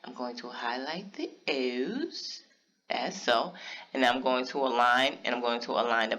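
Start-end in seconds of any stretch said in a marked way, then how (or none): 0.0-2.4 slow, whispered repeat after a slip. Real, not acted. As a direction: breathe the words out slowly, almost to yourself.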